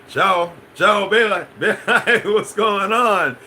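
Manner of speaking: high, loud, laughing ciao bella; excited Italian greeting. Acted. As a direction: greet her with a playful mock Italian ciao. Greeting viewer Francesca in Italian.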